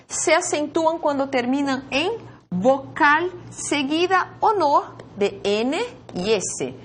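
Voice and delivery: normal talking voice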